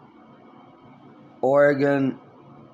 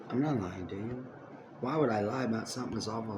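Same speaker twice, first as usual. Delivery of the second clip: earnest; 4 semitones lower, 10 dB quieter, faster than usual. Real, not acted.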